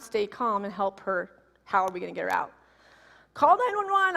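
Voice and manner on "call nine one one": high, loud panicked order; frantic call. Retold or imitated. Locked-in daughter's mother shouting for help. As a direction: yell it in a panic.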